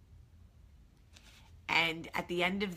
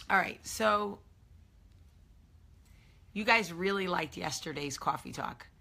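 ordinary talk, nothing marked

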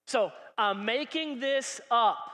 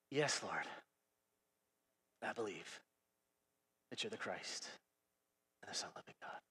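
much lower, fading into a whisper; hushed whisper; 16 dB quieter than usual. Shown as a demonstration. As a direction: barely breathe it out. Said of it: "I think she kinda said it like this".

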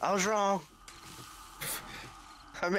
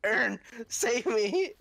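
high, drawn-out plea; playful cry for help; 7 semitones higher, slower than usual. Pretend. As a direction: call out slowly for rescue, pitched high. Calling teammate for rescue in game.

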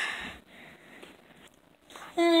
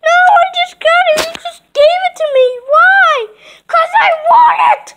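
squabbling doll; 7 semitones higher than usual. Pretend. Two dolls fight over the toy, high crying shout.

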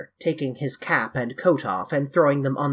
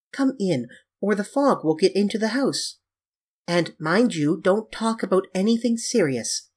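higher, light character voice for Dorian; airy young Dorian; 5 semitones higher than usual. Acted. Dorian welcoming the painter in from the fog, airy. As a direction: play a breezy host, pitched up.

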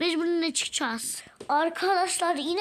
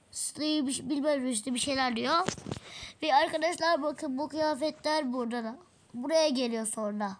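ordinary talk

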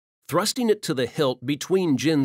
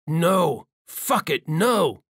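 louder, higher furious outburst; furious shout; 4 semitones higher, 3 dB louder, much slower than usual. Acted. Valen at the dying Benares, an angry shout. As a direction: rage at the loss of the answers.

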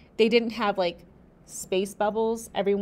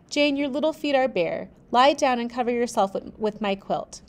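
usual speaking voice

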